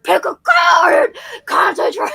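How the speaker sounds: high, slow mock yell, voice cracking; hammed-up shout of an angry man; imitating a yell. Imitating Anthony Hopkins yelling at his love interest, mock shout. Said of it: "yelling at her to be like".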